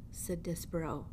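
ordinary delivery, nothing marked

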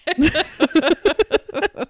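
high, loud laughing through words. Real, not acted. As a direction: crack up while talking. Laughing at the robotic line.

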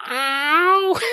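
mock yelp. Mock pained yelp after joke.

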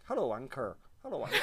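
put-on Irish accent. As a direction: imitate an Irish squawker, half laughing. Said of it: "it said it in an Irish accent".

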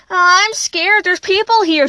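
put-on high, small nervous child voice; a frightened girl telling her brother, nervous. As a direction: sound like a scared little girl. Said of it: "she said in a nervous voice".

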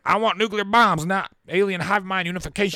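very high, loud mock Senate-floor shouting; debating senator. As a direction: thunder it out like an outraged senator. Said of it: "They're debating and they're like".

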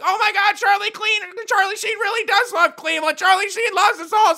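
very high, loud squealing adoration; squealing fans. Retold or imitated. Fans squealing that Charlie Sheen loves Cleveland, very high and loud. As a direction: swoon like starstruck fans. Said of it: "they're like".

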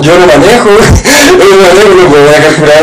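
high-pitched indignant retort, breaking into a laugh; retrucando indignado. Retold or imitated. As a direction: protest in a high, incredulous voice, half laughing. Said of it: "Y yo como".

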